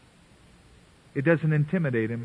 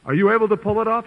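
higher, louder, fast scoff; challenging king; 6 semitones higher, 5 dB louder, faster than usual. Retold or imitated. King challenging Daniel, fast mocking tone. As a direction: fire the doubt at him quickly. King's challenge quoted again.

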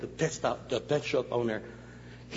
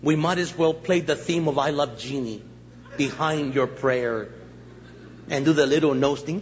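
usual speaking voice

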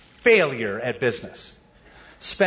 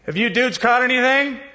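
high, loud shout across the water; Jesus calling out to fishermen on the boat, loud; 8 semitones higher, 3 dB louder than usual. Retold or imitated. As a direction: hail the boat from the shore. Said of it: "Jesus shouts out".

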